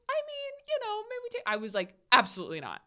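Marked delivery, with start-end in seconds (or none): 0.1-1.4 high-pitched coy hedging; cute response. Pretend. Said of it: "like, a cute response". 2.1-2.8 loud, low, emphatic refusal; intense refusal. Retold or imitated. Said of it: "I'm very intense".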